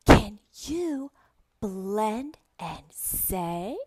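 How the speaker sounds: drawn-out question, launched high and loud; very slow, lilting teacher prompt; slow question to child. Shown as a demonstration. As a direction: stretch the words invitingly for a beginner. Asks imagined young student.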